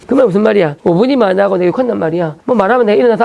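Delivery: normal talking voice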